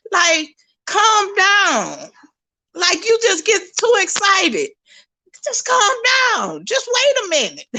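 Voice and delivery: high-pitched mock-exasperated son; retold exasperation, high and insistent; exasperated imitation. Son scolding excited mother, retold.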